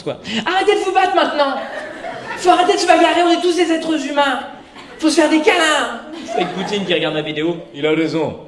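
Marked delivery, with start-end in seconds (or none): 0.5-6.0 hammed-up high care-bear plea; naive peace-lover. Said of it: "t'as l'air d'un bisounours". 7.7-8.4 put-on Russian accent. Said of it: "faut que je rebosse mon accent".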